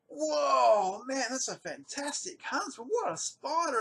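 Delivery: high, loud thrilled exclamation; delighted wide-eyed wonder; excited whoa. Retold or imitated. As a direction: gush over the spider like a fan. Excited about huge huntsman.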